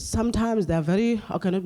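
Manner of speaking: ordinary talk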